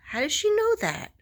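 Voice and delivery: can't believe she knows; amazed disbelief. Retold or imitated.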